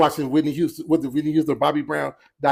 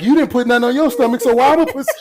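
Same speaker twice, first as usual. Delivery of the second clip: higher, louder mock-indignant comeback; indignant retort; 8 semitones higher, 6 dB louder than usual. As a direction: throw it back in mock protest. Acting out his retort to her.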